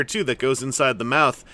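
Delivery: normal talking voice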